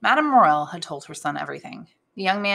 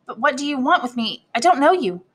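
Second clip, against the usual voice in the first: high, flustered questioning; mildly alarmed, raised voice; stammering; 8 semitones higher, 3 dB louder than usual. A narrator's reading. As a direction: protest nervously to a stranger, pitched up. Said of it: "stammered Julie".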